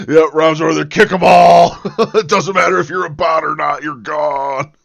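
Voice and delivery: loud, high comic bellow broken by laughs; laughing mock shout. Streamer jokes that the new mod kicks everyone, laughing shout. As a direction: yell it like a gleeful mock purge.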